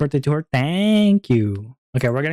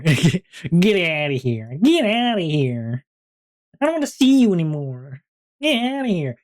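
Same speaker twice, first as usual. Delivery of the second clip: mock dismissal; 3 semitones higher than usual. Streamer shooing viewers off as stream ends, mock dismissal. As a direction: playfully send them packing, stretching the words.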